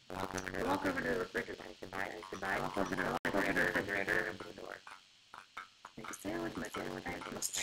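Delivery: a pompous cook narrating each step; Chef Lightning. Acted. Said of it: "Chef Lightning".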